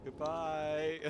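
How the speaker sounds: taunting shout. Real, not acted. Friend taunts, leaving ship.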